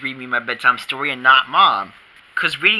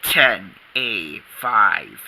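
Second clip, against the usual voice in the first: ordinary talk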